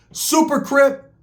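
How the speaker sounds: Super Crip stretched slowly and high; drawn out. Real, not acted. Emphatic label.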